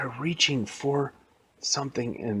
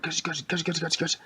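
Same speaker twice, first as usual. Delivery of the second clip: repeated mechanical "gush" noises, higher-pitched; imitated robot attack; 6 semitones higher, 3 dB quieter than usual. Robot old lady fighting heroes, imitated mechanical attack noises. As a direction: make rhythmic robot-weapon noises. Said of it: "she was like".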